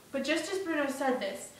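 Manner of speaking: ordinary delivery, nothing marked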